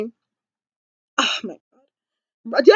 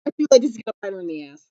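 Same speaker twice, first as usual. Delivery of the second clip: ordinary talk, nothing marked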